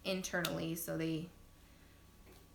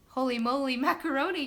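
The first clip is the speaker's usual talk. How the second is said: louder, higher re-enacted exclamation; retold yell; 5 semitones higher, 7 dB louder than usual. Mom repeating her labour outburst, mock yell. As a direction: call it out in mock shock. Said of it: "that was exactly what I said".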